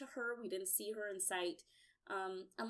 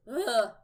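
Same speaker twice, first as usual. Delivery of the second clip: loud, frustrated "ugh"; short burst of disgusted frustration; exasperated groan; 13 dB louder than usual. Real, not acted. Fan fed up with a pointless death, exasperated groan.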